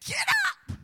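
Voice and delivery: very high, loud yell; acted startled captain's shout; shouted wake-up order. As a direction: yell at a sleeper to rouse him. Captain rousing the sleeping prophet.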